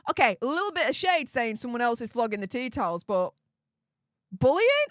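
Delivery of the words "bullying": incredulous. Real, not acted. Incredulous at the bullying claim.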